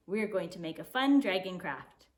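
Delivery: usual speaking voice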